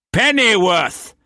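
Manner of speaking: captain bellowing for his second mate; bellowed name. A narrator's reading. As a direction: haul the name out across the deck. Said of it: "Brock bellowed to the second mate".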